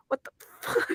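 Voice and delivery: breathy whispered what the fuck, laughing; whispered disbelief. Retold or imitated. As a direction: whisper it in amused shock. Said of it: "I go".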